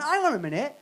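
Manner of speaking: mock furious rant at the shop cashier; exasperated rant. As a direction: scold the dozy cashier with growing outrage. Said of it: "And then I went".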